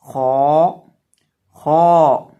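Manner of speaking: खौ with a thick, full-mouthed long vowel; drawn-out, emphatic खौ demo; heavy, full letter. Shown as a demonstration. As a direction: make खौ heavy and hold it out. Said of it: "खौ को भी हम मोटा करेंगे".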